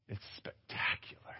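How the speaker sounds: whispered and slow, in awe; awed whisper. Retold or imitated. Gazing over the cliff, whispered in awe. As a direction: breathe it out over the view.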